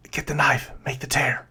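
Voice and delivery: hushed, voiceless whisper; little whisper. Acted. Game master as unseen voice calling to the other, whispered. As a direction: whisper a secret order to an accomplice. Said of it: "you hear this little whisper of a voice".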